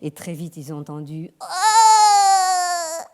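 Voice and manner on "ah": short high loud newborn wail; a newborn's first cry; mock baby cry. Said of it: "un cri de bébé".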